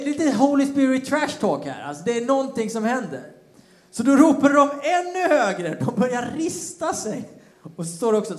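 usual speaking voice

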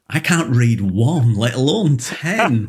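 slow, stretched-out disbelief, rising on ten; mock incredulity. Coach answering someone boasting about ten books, mock incredulous. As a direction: drag it out in exaggerated disbelief.

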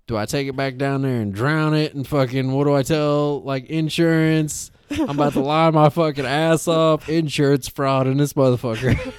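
mock panic. Driver retelling his plan to dad, mock-panicked scheming. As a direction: play up the frantic scheming, loud and high.

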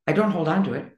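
ordinary delivery

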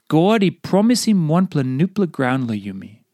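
ordinary talk

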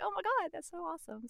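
high, mildly gushing delight; high-pitched replay of excitement; retold excited reaction. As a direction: re-enact delighted disbelief at good news. Retells own reaction to good news.

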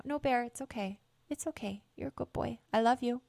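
soft, high, reassuring coo; gently calm the worried dog down; soothing the dog. Real, not acted. Owner softly reassuring her dog Bear after scolding him.

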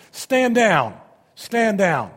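forceful command, second one slightly eased; commanding shout. Acted. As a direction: bellow it like a commander restraining troops. Acting the Father ordering angels back.

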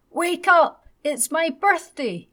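put-on high, loud, slow child's yell; little boy shouting. A narrator's reading. Little Ted shouts at his sleeping parents again, loud high child's yell. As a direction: shout it out like an eager small boy. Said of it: "Little Ted repeated loudly".

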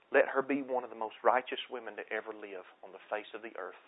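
soft, measured blessing; spoken blessing prayer. Shown as a demonstration. Father blessing his son, soft prayer voice. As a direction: pray it gently over the child. Speaks a blessing over children.

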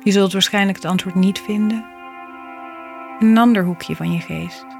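soft spa whisper. Acted. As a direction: read it gently like a calming mantra. Reflective poem read over music.

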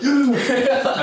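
high whooping cheer, laughing; mock a fired-up crowd cheer. Imitating sales guys cheering a speaker, high excited whoop. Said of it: "eight other sales guys were like".

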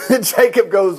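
ordinary delivery

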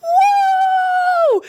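very high shouted woo; shrieking cheer. Real, not acted. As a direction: scream with joy. Cheering for season two.

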